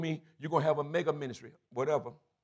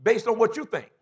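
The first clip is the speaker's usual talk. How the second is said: high, loud, shouting the questions at the church; full-force preaching; 8 semitones higher, 6 dB louder than usual. Real, not acted. Pastor challenging his congregation, preaching loud and high. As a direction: drive each line home like a call.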